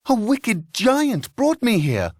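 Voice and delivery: high, pleading harp voice; harp crying out. A narrator's reading. The harp cries for help to the farmers. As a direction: cry it out in a high voice. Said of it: "she cried".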